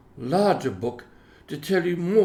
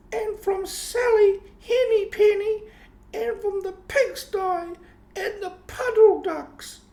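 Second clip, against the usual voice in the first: much higher, fussy mother cat scolding; 4 dB louder than usual. A narrator's reading. Mother cat lecturing her kittens, high and fussy. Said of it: "Tabitha instructs her kittens".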